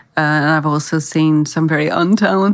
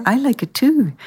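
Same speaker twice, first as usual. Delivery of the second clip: put-on higher, sweet ad-girl line; lightly voiced commercial girl; 7 semitones higher than usual. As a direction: toss it off like a soap ad actress. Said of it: "she would say".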